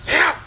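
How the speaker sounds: sudden loud dog yelp; imitated dog bark.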